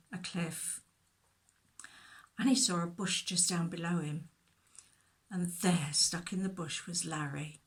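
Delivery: ordinary delivery, nothing marked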